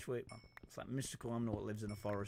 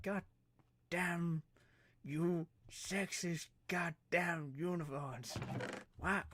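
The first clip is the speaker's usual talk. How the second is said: higher, louder mock fury; mock rant; 7 semitones higher, 3 dB louder than usual. Man denied a unicorn ride, hammed-up outrage. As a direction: rail at them like a jilted fan.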